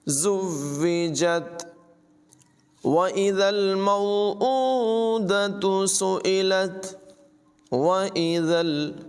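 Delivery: slow chanted tajweed recitation; Quran recitation. Shown as a demonstration. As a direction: recite it melodically, drawing out the vowels. (धीमी, सुरीली क़ुरान तिलावत)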